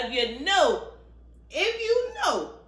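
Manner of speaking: high, slow drawn-out "if you know"; mock knowing aside. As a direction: sing it out high and slow like a wink.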